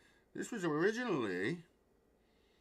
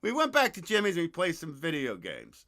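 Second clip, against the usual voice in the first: ordinary talk, nothing marked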